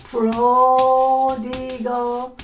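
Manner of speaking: Prodigal drawn out very slowly, each syllable clear; slowly stretched word. Shown as a demonstration. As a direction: stretch out Prodigal for learners to hear. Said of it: "Prodigal son means".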